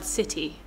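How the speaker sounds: city with a clear T. Shown as a demonstration. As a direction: sound the T in city crisply. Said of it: "it's city with a kind of T".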